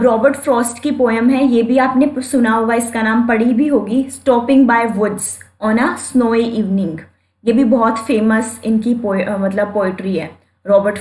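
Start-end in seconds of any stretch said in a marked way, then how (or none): 4.1-7.0 drawn-out announcing of the poem title; slow title reading. Shown as a demonstration. Teacher announcing the poem title slowly. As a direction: read the title slowly and clearly.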